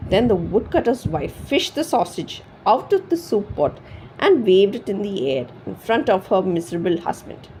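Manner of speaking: plain reading voice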